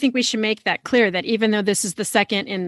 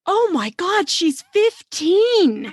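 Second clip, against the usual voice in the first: high-pitched, drawn-out reader's shock; stunned disbelief; 8 semitones higher, much slower than usual. Retold or imitated. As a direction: gasp it out as an appalled reader, stretching the words. Acts out her shock while reading.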